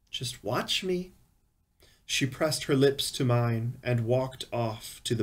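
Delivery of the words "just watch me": high, soft, slow woman's voice; voiced wife. A narrator's reading. Wife answers husband's doubt, high soft voice. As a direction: play the wife, softly and slowly pitched up.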